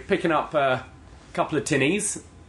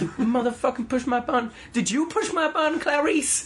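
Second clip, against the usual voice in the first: high-pitched mock cursing tirade; imitated irate clerk; 11 semitones higher than usual. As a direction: accuse a coworker in a very high put-on voice. Acting out clerk cursing a coworker.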